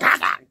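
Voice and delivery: loud sharp "ah, ah" correction; mother dog warning snap. Retold or imitated. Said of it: "she's gonna be like".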